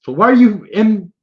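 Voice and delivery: high, loud goblin demand; goblin pressing. Acted. Goblin demanding why the adventurer came down, high and loud. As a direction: push for an answer.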